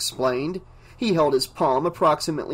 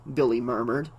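ordinary talk

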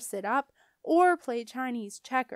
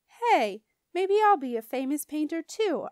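excited; 6 semitones higher, 6 dB louder than usual. Acted. Junie announces her dream job.

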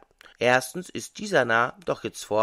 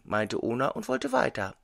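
plain reading voice

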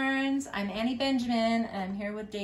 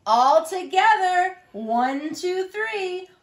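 high, raised storybook count-in; rally everyone for one big heave; 9 semitones higher, 8 dB louder, much slower than usual. A narrator's reading. Animals push the trucks together, high rallying call.

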